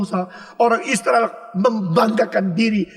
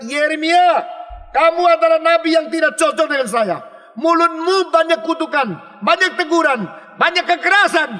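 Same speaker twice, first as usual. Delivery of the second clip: angry complaint; 6 semitones higher than usual. Retold or imitated. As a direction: throw the complaint at the prophet. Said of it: "Maka mereka bilang".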